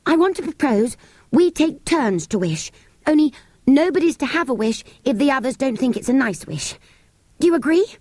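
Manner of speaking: breathless from running, push it out; panting. A narrator's reading. Anthea proposing to the others after running, panting. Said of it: "panted out".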